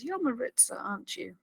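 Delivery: soft retold realisation, fading; very quietly. Kira confronting the prisoner, very quietly. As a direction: imitate a hushed, probing question. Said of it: "she very quietly says".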